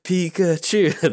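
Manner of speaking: high, drawn-out mock cheer; imitated crowd yelling. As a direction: chant it like a parade crowd. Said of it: "people started yelling".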